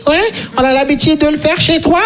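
mock-sweet interrogation of the neighbour; prying neighbour. Comedian imitating nosy old neighbour Yvonne asking questions.

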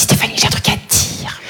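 hushed secretive whisper; gossip whisper. Retold or imitated. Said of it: "Elle vient voir, elle me dit". (chuchotement de commère)

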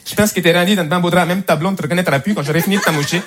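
thick Québécois twang from Montreal; Montreal accent. Acted. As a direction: threaten unconvincingly in a Montreal accent. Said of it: "L'accent de Montréal, par exemple". (accent québécois de Montréal, menace peu crédible)

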